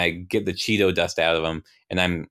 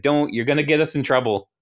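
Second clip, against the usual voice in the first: higher, lightly voiced worried objection; anxious protest pitched up a little; timid, higher-voiced warning; young Ryan; 7 semitones higher than usual. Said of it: "young Ryan's like".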